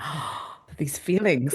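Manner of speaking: soft, gasping mock swoon; swooning crush. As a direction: re-enact a hushed first-crush awakening.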